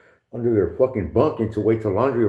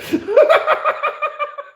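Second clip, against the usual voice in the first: high burst of laughter; big laugh; 3 dB louder than usual. Real, not acted. Remembering cellmate's joke, real high laugh. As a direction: crack up loudly.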